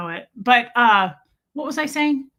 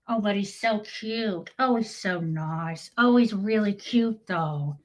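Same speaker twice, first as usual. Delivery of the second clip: cooing; slower than usual. Real, not acted. Cooing over the cat.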